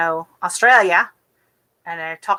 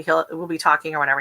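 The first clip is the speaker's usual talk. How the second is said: ordinary talk, nothing marked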